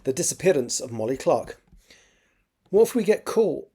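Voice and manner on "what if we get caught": put-on higher, anxious teenage boy; fretting lad pitched up; nervous young boy. Nervous boy voiced in story.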